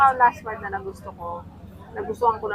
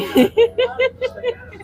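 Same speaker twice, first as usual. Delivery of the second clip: high laugh; 5 dB louder than usual. Real, not acted. Laughing mid-story.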